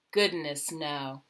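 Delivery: ordinary delivery, nothing marked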